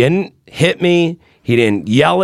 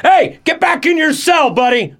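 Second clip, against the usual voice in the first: cop voice. Retold or imitated. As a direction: snap an order like a guard, pitched up. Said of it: "the fucking cop voice comes out".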